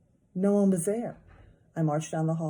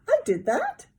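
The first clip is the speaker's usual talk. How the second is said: put-on high loud little-kid voice; toddler voice; 6 dB louder than usual. A narrator's reading. Toddler hearing of his mess, high delighted kid voice. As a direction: sound amazed.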